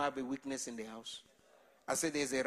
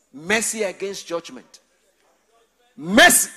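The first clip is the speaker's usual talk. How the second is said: much louder shouted plea; crying out mercy; 3 semitones higher, 15 dB louder than usual. Real, not acted. Preacher crying out for mercy in prayer, loud.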